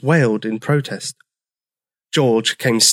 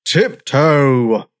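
drawn-out, restrained bellow; 3 semitones higher, 3 dB louder than usual. A narrator's reading. Old Triceratops annoyed by footsteps, bellowing. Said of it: "George would bellow".

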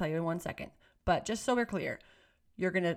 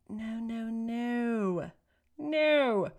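emphatic no; 3 semitones higher, much slower than usual. Acted. Rejecting screaming volume battles.

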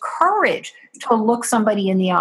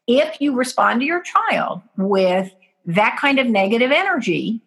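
normal talking voice

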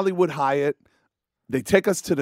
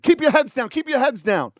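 shouted orders; 8 semitones higher than usual. Retold or imitated.

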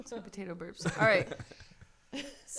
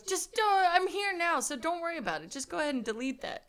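hurried reassurance; 8 semitones higher, 4 dB louder than usual. Retold or imitated. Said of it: "He goes".

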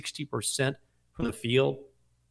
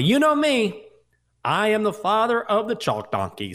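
playful boast; 9 semitones higher, 5 dB louder than usual. Real, not acted. Playful self-mockery.

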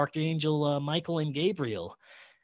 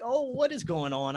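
higher-pitched mock alarm; retold alarm; 9 semitones higher than usual. Man seeing his girlfriend turn reptilian, retold alarm. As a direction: act out a startled reaction, lightly.

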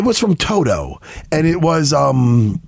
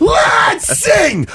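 rally the room to sing; excited shout; 13 semitones higher than usual. Real, not acted. Hyped up to sing.

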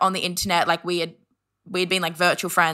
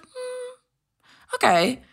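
doubtful acknowledgement; much slower than usual. Retold or imitated. Friend reacting to the awkward admission, doubtful and high. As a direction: take it in uncertainly.

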